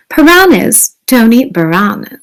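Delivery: plain reading voice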